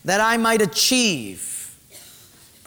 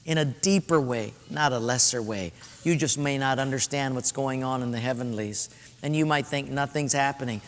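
soft reassurance; 6 semitones lower, 5 dB quieter than usual. Retold or imitated. Angel's reassurance quoted softly.

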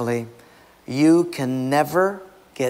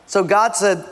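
ordinary delivery

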